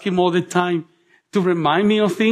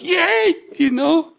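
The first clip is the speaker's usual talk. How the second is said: excited cheer; 10 semitones higher, much slower than usual. Retold or imitated. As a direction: squeal with glee at the screen. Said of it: "and I'm like".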